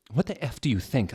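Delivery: ordinary delivery, nothing marked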